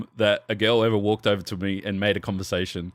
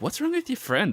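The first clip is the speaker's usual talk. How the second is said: put-on higher, offended girl voice; 13 semitones higher than usual. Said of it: "the girl's like".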